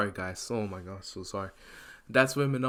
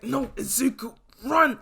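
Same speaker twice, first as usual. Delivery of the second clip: urgent cry; 12 semitones higher than usual. Acted. Teacher urging student to flee.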